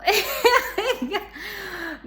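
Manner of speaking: a real high laugh breaking out; high burst of laughter. Real, not acted. Laughing at herself after apologizing again.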